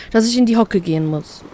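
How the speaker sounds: plain reading voice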